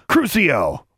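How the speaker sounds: high, sudden incantation; spell cast sharply. A narrator's reading. Bully thrusts his wand at the girl, casting sharply.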